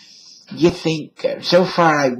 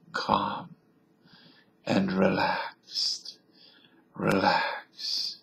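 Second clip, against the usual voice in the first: mock hypnotist; much slower than usual. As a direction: lull them as a pretend hypnotist. Mock hypnotizing after the southern bit.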